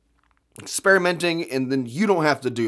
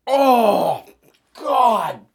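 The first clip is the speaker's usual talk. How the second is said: loud, high anguished cry; 6 semitones higher, 5 dB louder than usual. Real, not acted. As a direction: cry out in misery. Taste won't go away.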